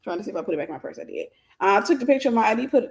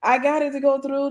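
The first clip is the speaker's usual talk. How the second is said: higher voice mimicking his triumph; retold gleeful boast, pitched up; joyful celebration; 4 semitones higher, 4 dB louder than usual. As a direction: imitate the agent celebrating proudly. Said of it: "his joy and celebration".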